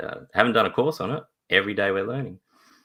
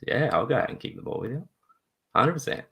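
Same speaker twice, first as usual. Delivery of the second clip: happy eager yes; 6 semitones higher, faster than usual. Retold or imitated. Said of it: "big smile on my face".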